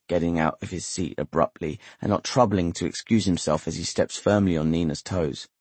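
usual speaking voice

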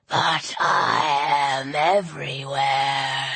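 very low, slow, drawn-out serpent voice; serpent villain. A narrator's reading. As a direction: menace them slowly from deep down. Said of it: "the serpent spoke through the statue's mouth".

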